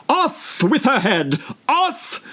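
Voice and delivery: high, slow, furious royal scream; screaming queen. A narrator's reading. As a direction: shriek the order like a tyrant queen.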